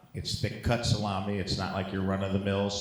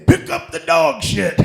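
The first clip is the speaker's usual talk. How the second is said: mock comic punchline; 11 dB louder than usual. Punchline quote, high loud.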